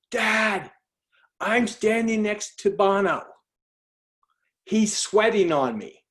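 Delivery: high, slow, stretched-out thrilled voice; excited daughter. Retold or imitated. Daughter calling dad from beside the rock star, thrilled. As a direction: imitate a daughter bursting with news, high-pitched.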